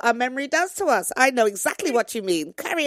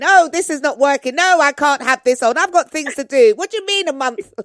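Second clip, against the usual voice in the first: loud mock whinge, a bit higher; whinging; 6 semitones higher, 9 dB louder than usual. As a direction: whine like a fed-up patient. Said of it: "Constant whinging".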